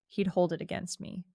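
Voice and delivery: ordinary delivery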